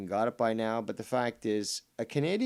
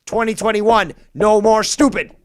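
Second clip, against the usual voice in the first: louder, higher rallying proclamation; slogan declared; 7 semitones higher, 11 dB louder than usual. Real, not acted. Ranting streamer declaring his new-year motto, loud and high. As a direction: proclaim it like a battle cry.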